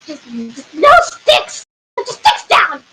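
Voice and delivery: halting, then rising to a cry; exasperated command. Acted. Council member orders guards to disarm, exasperated cry. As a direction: order them to stop, voice climbing.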